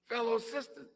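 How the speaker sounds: ordinary talk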